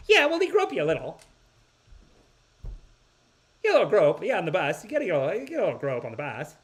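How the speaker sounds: high, loud mock women's voice; mocking high-pitched impression of blasé travelers; mimicking dismissive women. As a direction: pitch it up, breezily shrugging it off.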